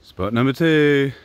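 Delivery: panting after the climb; short of breath, words pushed out. Real, not acted. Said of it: "Oh, I'm a bit out of breath".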